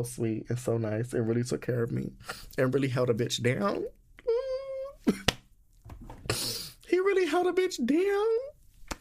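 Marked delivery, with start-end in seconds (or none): 4.3-5.3 a high broken sob-laugh over the man she misses; choked crying laugh. Real, not acted. Missing the kind bellhop, a high crying laugh. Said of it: "really crying". 6.9-9.0 much higher and slow, voice cracking with feeling; emotional wail. Real, not acted. Missing the kind bellhop in Madrid, a high slow tearful wail. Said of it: "gonna get too emotional".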